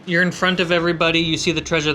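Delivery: ordinary talk, nothing marked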